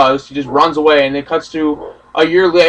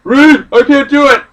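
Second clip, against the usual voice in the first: mock whine; 8 semitones higher, 6 dB louder than usual. Heroes whining to Reed.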